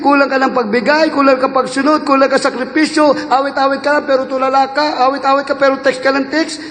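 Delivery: normal talking voice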